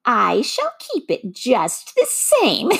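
high, slow taunting witch voice; laughing witch. A narrator's reading. The witch taunts Dorothy, high, slow and laughing. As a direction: taunt slowly, ready to break into a cackle. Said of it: "laughing at her".

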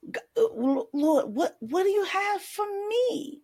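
stammering, rising high on me; mock hesitant prayer. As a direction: fumble for the word Lord, then ask up high.

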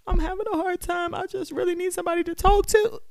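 a hint of someone reaching out for help; struggling poster. Mimicking a struggling person recording a plea for help, lightly voiced. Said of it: "or are you recording yourself".

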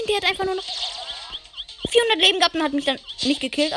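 indignant. Real, not acted. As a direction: complain in disbelief. Outraged at missed kill.

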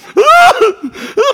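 high loud cackle; loud burst of laughter. Real, not acted. Preacher bursting into laughter at his own story. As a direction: explode into laughter.

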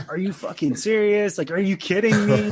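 high, loud disbelieving excitement; freaking out. Retold or imitated. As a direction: yelp it in thrilled disbelief. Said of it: "He starts freaking out".

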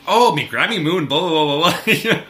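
high, loud mock Manchester Daphne; hammed-up Manchester accent; imitating Manchester accent. Fan imitating Daphne's Manchester accent, loud and high. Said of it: "He's like".

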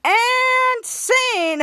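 high, loud drawn-out announcement; triumphant sign-off. Acted. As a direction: proclaim it like ending a performance. Announces end of his performance.

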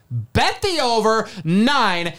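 high-pitched, excited tout sell; hyped betting pick. Real, not acted. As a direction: build to a big high-energy payoff.

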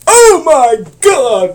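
slow, high, loud cry of horror; real horrified wail, stretched out; horrified shout. Real, not acted. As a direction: cry out in drawn-out revulsion. Horrified by the picture.

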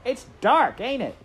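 put-on high, loud serving-girl shout. A narrator's reading. Serving girl complaining to the rat in her pocket, shouting. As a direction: holler it at your companion. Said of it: "she shouted to the rat".

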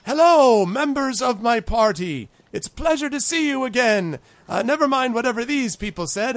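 high-pitched, grandiose little greeter; high goblin voice playing the dignitary; self-important goblin ambassador. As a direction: welcome them loudly in a high, pompous voice. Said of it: "and he says".

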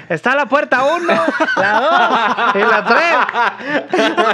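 lightly voiced game-show host, laughing; a hint of Chabelo offering the doors. To the dad, a mild mock game-show host. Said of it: "el papel de Chabelo".

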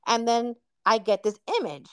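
ordinary talk, nothing marked

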